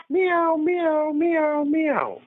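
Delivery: high-pitched imitation meowing; playful cat sound for a memory game. Shown as a demonstration. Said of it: "Number one".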